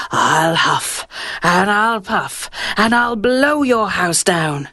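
slow, lightly menacing wolf; a hint of a wolf's threat, drawn out; wolf threatening the pig outside his house; big bad wolf.

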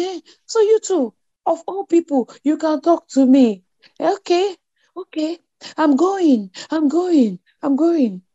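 high, whiny mock sob; imitating fake crying. Mother-in-law playing the victim, mock tearful high whine. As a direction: ham up a wounded, put-upon wail. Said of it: "she started faking this cry".

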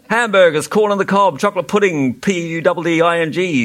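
high, punched-out list; reciting. A narrator's reading. Spelling bee making his speech, reciting. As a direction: reel off dishes, then spell the last.

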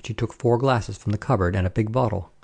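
ordinary delivery, nothing marked

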